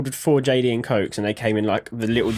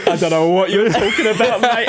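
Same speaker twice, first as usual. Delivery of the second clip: laughing deadpan denial; 5 semitones higher, 3 dB louder than usual. Retold or imitated. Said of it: "looked him in the fucking eyes".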